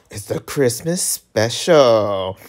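usual speaking voice

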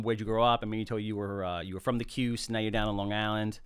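normal talking voice

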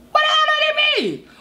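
fast, high exasperated yell; baffled high shout. Real, not acted. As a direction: fire the question off in disbelief. Baffled by song title.

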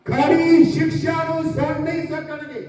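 high, slow sung-out sermon lines; intoned preaching. Acted. Preacher intoning to the church, slow and high over music. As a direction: chant the scripture warning slowly over the music.

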